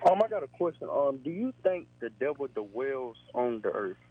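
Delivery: over the phone line; thin call-in phone sound; radio caller. Real, not acted.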